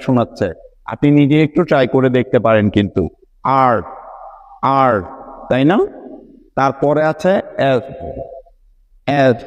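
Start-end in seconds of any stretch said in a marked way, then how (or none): 3.6-4.9 "আর" with the tongue curled back; rounded English R. Shown as a demonstration. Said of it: "জিহ্বাটা ভিতরে fold হয়ে round হয়ে থাকে". 9.2-9.4 "এস" with a long hiss of air out of the mouth; hissed letter S; airy S. Shown as a demonstration. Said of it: "অনেকটা air বের হয়ে যাচ্ছে".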